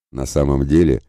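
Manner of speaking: usual speaking voice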